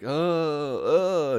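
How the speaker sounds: high, drawn-out mock moan; mock labour groan. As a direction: imitate a woman groaning in labour. Acting Sophie moaning in labour.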